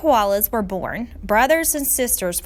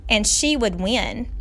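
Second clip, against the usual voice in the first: plain reading voice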